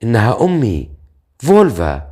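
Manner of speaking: a touch of tremulous emotion; mildly shaken, on the verge of tears; trembling voice. A narrator's reading. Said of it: "وقالت بصوت مرتجف".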